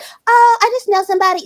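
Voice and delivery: high, timid put-on voice for a shy friend; imitating a shy friend in a made-up voice. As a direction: raise the voice way up and sound hesitant. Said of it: "I'm gonna give her this voice".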